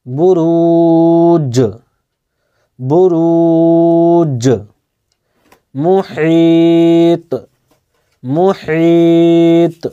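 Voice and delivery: বুরুজ drawn out in a chanting tone, the final জ bounced; slow recited বুরুজ with an echoing end; qalqalah on jim. Shown as a demonstration. Teacher repeats the word for learners, slow and chanted. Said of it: "এটিকে উচ্চারণ করে আমি বলছি".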